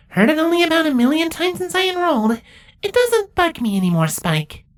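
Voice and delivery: very high, dry changeling voice; high squeaky deadpan teen; deadpan snarker. Acted. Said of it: "deadpan snarker".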